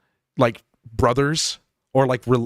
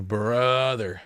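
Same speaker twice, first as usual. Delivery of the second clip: mock dismay; 2 semitones lower, 3 dB quieter than usual. Creator scolding his own dull recording voice, mock dismay. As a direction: groan it out in weary disbelief. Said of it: "And it's like".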